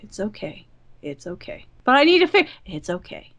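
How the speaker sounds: hushed comforting to a perfectionist friend; soothing. Retold or imitated. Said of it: "pull him into my arms".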